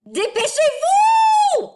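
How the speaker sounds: high, slow, loud shout of hurry up; a hammed-up parent's hurry-up shout. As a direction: yell it at late kids. Acted shout in example sentence.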